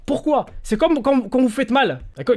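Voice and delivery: ordinary talk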